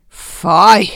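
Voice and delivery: loud, sharp cry of disgust. Acted. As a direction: spit it out in revulsion. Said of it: "råbte hun". (højt udbrud af afsky)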